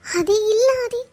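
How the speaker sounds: high, upset plea; imploring, with pitch climbing; tearful pleading. Acted. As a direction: beg to be understood, close to tears. Girl begs angry man to understand.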